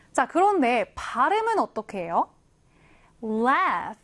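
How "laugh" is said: "laugh" ending in an F sound; final F sound. Shown as a demonstration. Teacher showing learners how it is pronounced, final F sound in "laugh". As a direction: model "laugh" with its GH said as F. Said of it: "F 발음이 들어가게 됩니다".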